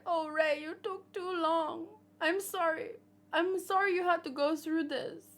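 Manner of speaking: high, mock-weepy quote; tearful reunion voice. Rescued woman to Ray after waiting so long, tearful and high. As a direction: act her out, high and plaintive.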